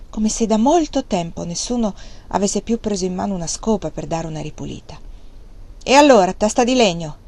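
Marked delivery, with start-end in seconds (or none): 5.8-7.2 louder and higher, scornful snap; loud harsh taunt. A narrator's reading. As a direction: bite at him with contempt. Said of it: "disse la donna molto aspramente".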